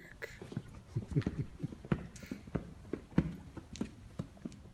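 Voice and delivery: long, quiet, breathy chuckle; soft chuckle. Acted. Vampire amused at his own turn of phrase, chuckling softly.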